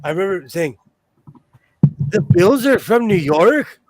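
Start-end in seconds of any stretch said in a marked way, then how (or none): 2.1-3.8 loud, rising, baffled question; puzzled disbelief, pitch jumping up; confused kid. Retold or imitated. Recalls asking this out loud as a kid, all confused. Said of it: "Just all confused".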